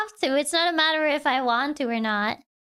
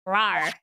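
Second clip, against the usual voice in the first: low playful rawr; tiger roar; 10 semitones lower than usual. Acted. Acting out the tiger roaring at the visitors, low. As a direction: give a deep mock roar. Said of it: "[name] making tiger noises".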